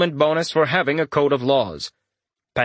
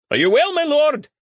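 high, raised call across a room; 3 dB louder than usual. A narrator's reading. Smith checking on his lord, calling out. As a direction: call up to a lord in a much higher voice. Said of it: "one of the smiths called out".

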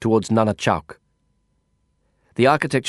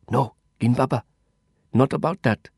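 scratchy whisper. Acted. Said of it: "he rasped in a scratchy whisper".

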